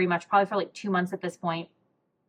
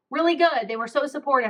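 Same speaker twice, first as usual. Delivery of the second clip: bright and pitched up, a cheerful cover answer; breezy brush-off; 6 semitones higher than usual. Retold or imitated. Answering friends who ask how it went, breezy brush-off. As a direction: toss it off lightly to close the subject.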